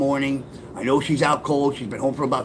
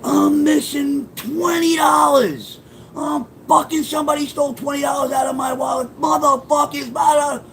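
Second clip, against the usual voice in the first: imitating his mom's shrill yell; mother's screaming rant; 11 semitones higher than usual. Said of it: "She starts screaming".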